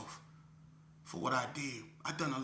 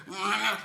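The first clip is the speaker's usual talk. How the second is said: short loud dog growl; 7 semitones higher, 6 dB louder than usual. Retold or imitated. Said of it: "the dog said".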